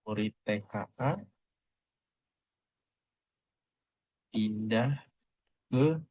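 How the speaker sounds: halting, one word at a time with long pauses; word-by-word dictation. Shown as a demonstration. Teacher writing out the translation, reading each word separately. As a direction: space every word out, fairly softly.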